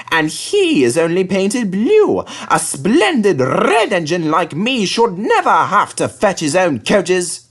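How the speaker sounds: high, slow, vain engine grumbling; a proud engine feeling hard done by; slow, sulky self-pity; sulky James. A narrator's reading. James sulks to himself.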